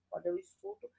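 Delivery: ordinary talk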